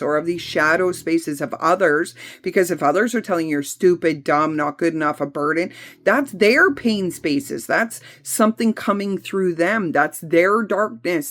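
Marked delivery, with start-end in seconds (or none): none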